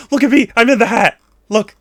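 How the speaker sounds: high, loud and fast show-off squeal. Pretend. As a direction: brag it out quickly like a proud little critter. Voicing show-off character in picture.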